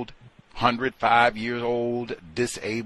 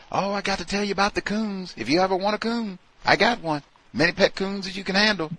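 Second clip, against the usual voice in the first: high, fast, eager pitch; mock eager coworker; 11 semitones higher, faster than usual. Coworker pitching pet coons, high eager voice. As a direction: gush about pet coons like a salesman. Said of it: "if they were coming to you".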